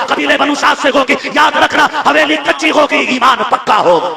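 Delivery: high, loud, sustained sermon cadence; preacher telling the nursing story at full force; preaching at full force. Acted. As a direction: drive the story to the crowd at full pitch.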